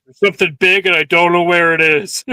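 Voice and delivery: loud, bewildered creature thought; voicing a confused pet, loud. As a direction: blurt it out baffled. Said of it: "So he's like".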